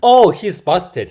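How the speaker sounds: loud, slow, triumphant Oh; gleeful gotcha. Real, not acted. Reacting to the bike thief being caught, loud gleeful gotcha. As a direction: savour catching the thief.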